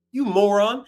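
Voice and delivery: ordinary talk, nothing marked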